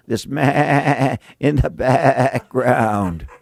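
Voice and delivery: mock sheep bleat.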